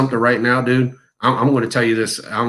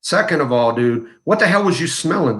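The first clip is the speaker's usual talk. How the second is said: ordinary talk, nothing marked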